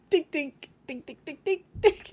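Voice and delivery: high chirpy mock "dink" robot sounds; Spaceballs dink noises. Teasing her friend by mimicking the Spaceballs dink dinks. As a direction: imitate the little Spaceballs robots going dink. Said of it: "the dink dinks from Spaceballs are like".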